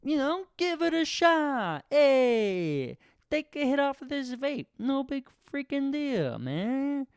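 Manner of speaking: high, slow stoner drawl pushing a vape; lazy high stoner voice offering a hit; stoner accent. Pretend. Peer pressuring friend with a vape, high lazy stoner voice. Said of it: "should I have done that accent?".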